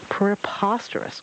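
slow, lightly voiced pompous owl. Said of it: "quoted Owl".

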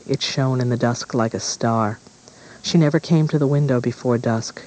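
ordinary delivery, nothing marked

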